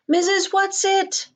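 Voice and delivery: high, loud, slow cry for help; despairing call. A narrator's reading. Meg held by the men, calling despairingly for Missus Whatsit. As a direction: call out desperately into the void. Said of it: "Meg called despairingly".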